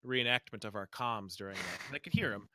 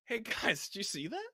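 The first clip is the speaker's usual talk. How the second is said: mock show-off teammate; 10 semitones higher, 5 dB louder than usual. Mimicking teammate's proud moment.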